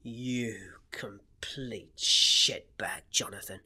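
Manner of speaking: hissed, breathy put-down; whispered insult. Real, not acted. Co-host whispering an insult at Jonathan.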